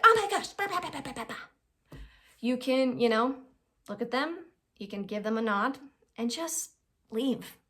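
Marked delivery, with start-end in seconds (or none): 0.0-1.4 high, hammed-up exasperated blah-blah; pitched-up mocking dismissal; mock huff. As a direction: imitate someone storming off in a huff. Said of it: "leave in a huff".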